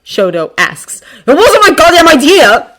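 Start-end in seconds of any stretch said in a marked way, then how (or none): 1.3-2.7 high, loud defensive outburst; gritted-out anger. A narrator's reading. Bakugo snaps back at Shoto's accusation, gritting it out. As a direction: force it out through gritted teeth. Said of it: "he grits out at him".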